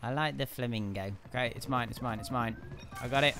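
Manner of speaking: ordinary talk, nothing marked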